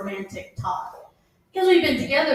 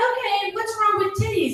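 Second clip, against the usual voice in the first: incredulous; 8 semitones higher than usual. Retold or imitated. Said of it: "I'm like".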